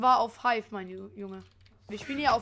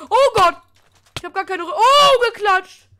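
high, loud shocked outburst; shocked yelling; 7 semitones higher, 12 dB louder than usual. Real, not acted. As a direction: cry out as you get wrecked. Getting killed in the fight. (erschrocken laut aufschreiend)

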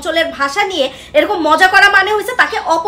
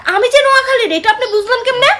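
high, astonished question; surprised customer; 6 semitones higher than usual. Acted. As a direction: ask it in disbelief. (অবাক হয়ে প্রশ্ন)

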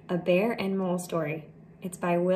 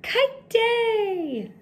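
high, loud, gleeful call; joyful shout; 5 dB louder than usual. Acted. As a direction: shout it out to the whole sky. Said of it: "then shouted".

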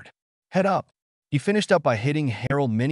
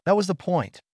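cried out; 9 semitones higher than usual. A narrator's reading. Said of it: "Chef cried".

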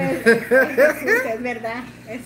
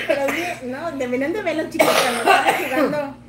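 normal talking voice

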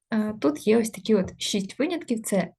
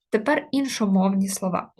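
ordinary talk, nothing marked

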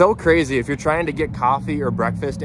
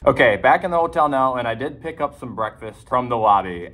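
normal talking voice

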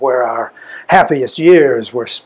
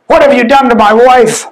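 higher, louder outcry of grief; raised anguished demand; anguished accusation; 7 semitones higher, 4 dB louder than usual. Acted. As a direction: hurl the question at them. Grieving husband accuses the parish.

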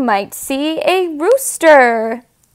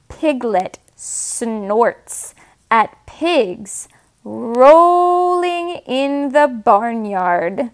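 plain reading voice

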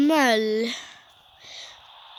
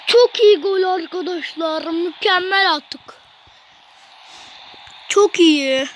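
louder, thrilled commentary; excited cheering; 6 dB louder than usual. Real, not acted. Boy telling his viewers about the goal, thrilled.

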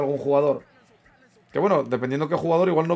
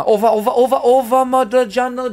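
excited cheering; 8 semitones higher, 6 dB louder than usual. Real, not acted. Cheering his striker's goal.

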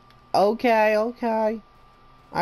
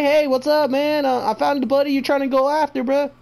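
mock call to the monster; 6 semitones higher, 5 dB louder than usual. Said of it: "Do you say".

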